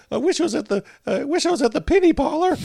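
high-pitched mock kid's complaint; whiny younger self. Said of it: "I was probably like".

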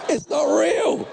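high, loud mocking cry of it's not real; mock diva shout. Preacher mocking conference culture with a shrill shout. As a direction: belt it like a scornful diva.

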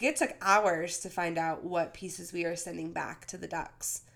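usual speaking voice

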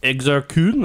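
hint of a mock Swedish Chef; light sing-song Swedish Chef impression. Shown as a demonstration. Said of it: "that's my normal voice".

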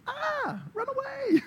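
comic scream of someone fleeing in fear; mock terrified. Preacher reacting to a church planter's calling, mock terrified. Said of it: "that's terrifying".

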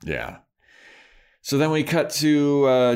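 ordinary talk

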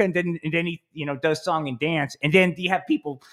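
normal talking voice

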